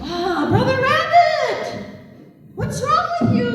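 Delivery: high, stretched-out storybook concern; astonished greeting. Acted. Trickster walks up to crying rabbit, astonished. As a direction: call out high with long vowels.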